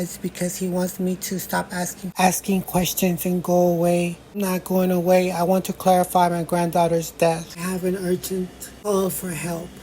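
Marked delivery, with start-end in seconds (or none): none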